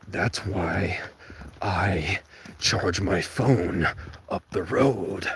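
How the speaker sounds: slow hushed whisper. Real, not acted. Muttering about the no outlet sign in a whisper. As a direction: breathe it out slowly.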